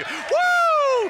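high, drawn-out "woo"; excited whoop. Real, not acted. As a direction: whoop for joy mid-sermon. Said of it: "I just got excited".

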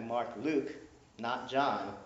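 usual speaking voice

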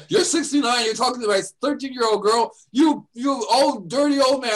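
angry mother. Retold or imitated. Angry mother scolds him.

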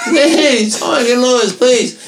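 high, pleading call with a laugh; whiny calling out. Retold or imitated. Drunk friend calling from the barbershop bathroom for Lewis, high whine. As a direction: call for help like a helpless drunk.

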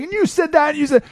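high, shrill mock bickering women; mock catfight yelling. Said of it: "women yelling at each other".

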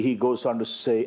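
ordinary talk, nothing marked

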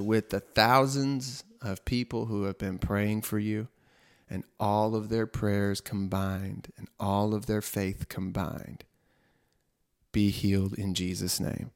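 quiet prayer. Retold or imitated. Prayer over the sick father.

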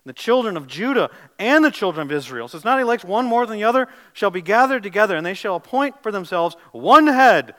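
loud, ringing scripture reading from the pulpit; full-force preacher's read; preaching scripture. Acted. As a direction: proclaim the verses to the whole room.

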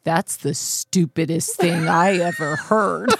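slow, tearful mock outburst; imitating angry child. Hurt four-year-old son answering parent, mock angry tearful outburst. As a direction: play an indignant crying four-year-old. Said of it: "He'd get angry".

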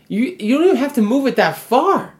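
high, loud indignant complaint; exasperated yell. Acted. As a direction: yell it across the gym at the offender. Acted yelling at gym bro.

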